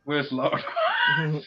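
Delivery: very high, slow and laughing; high laughing echo; laughing mock call. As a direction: squeal the name through laughter. Echoing the Lardos line, laughing.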